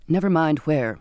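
ordinary delivery

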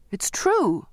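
high, pleading outburst; girl pleading to be believed by the captain; desperate cry. Acted. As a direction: insist with rising urgency. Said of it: "I cried".

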